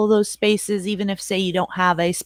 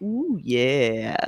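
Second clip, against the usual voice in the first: sexy voice; 7 semitones lower, 5 dB quieter than usual. Pretend. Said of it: "In that sexy voice".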